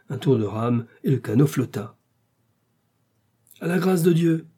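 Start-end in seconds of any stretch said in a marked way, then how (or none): 3.6-4.5 high, sustained call; crying out. A narrator's reading. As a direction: launch the boat with a cry. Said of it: "cria le patron".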